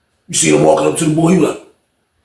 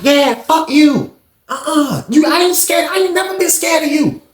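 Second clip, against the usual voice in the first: retold taunting; 10 semitones higher than usual. The boy taunting Sean outside the cell, high yelling. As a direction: jeer at him from the tier.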